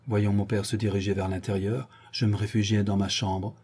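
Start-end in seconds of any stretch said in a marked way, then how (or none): none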